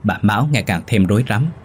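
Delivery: usual speaking voice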